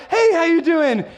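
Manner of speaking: put-on high, bright kid voice; chirpy child calling hello; cheerful kid greeting. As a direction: play an eager kid saying hi.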